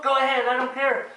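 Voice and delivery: high mock thick accent; new Kermit. Imposter frog waving the Muppets on, thick mock accent. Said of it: "he had a thick accent".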